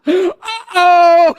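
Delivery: high, loud cartoon alarm; mock devil panic. Demon realizing it must leave, high and loud. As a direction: yelp like a startled devil.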